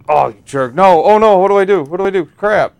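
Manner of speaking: loud panicked yelling; frantic high shouts; man down shout. Real, not acted. Game danger, panicking.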